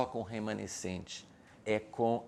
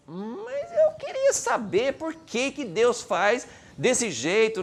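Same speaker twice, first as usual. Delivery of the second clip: drawn-out, high-pitched plea to understand; acted, slow exasperated wondering; frustrated questioning; 10 semitones higher, 5 dB louder, slower than usual. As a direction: stretch it out like a puzzled believer. Voices a believer's frustrated question.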